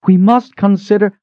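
put-on high, loud commanding old queen; Queen Mother calling out. A narrator's reading. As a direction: call out over the crowd as the queen.

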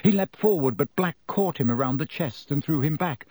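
ordinary delivery, nothing marked

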